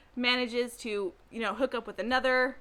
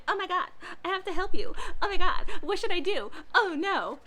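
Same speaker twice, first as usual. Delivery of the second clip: mock panicked heroine; 7 semitones higher than usual. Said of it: "And she's like".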